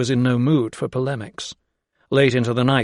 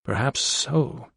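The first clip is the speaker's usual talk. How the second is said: hesitant; 4 dB quieter, slower than usual. A narrator's reading. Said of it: "with a note of hesitation".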